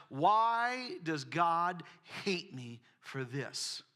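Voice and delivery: slow, drawn-out self-pitying wail; whiny lament. Retold or imitated. Preacher mimicking a fearful believer blaming God, slow and whiny. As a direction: drag it out like a sorry victim.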